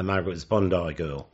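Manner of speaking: normal talking voice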